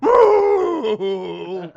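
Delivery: big comic moo; imitated cow moo. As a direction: bellow like a cow.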